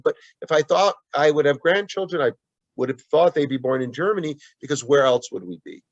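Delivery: ordinary talk, nothing marked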